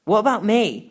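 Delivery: ordinary delivery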